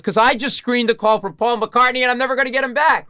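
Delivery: high, loud wail of regret; panicked despair. Retold or imitated. Podcaster who screened the star's call, high panicked wail. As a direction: wail it like all is lost.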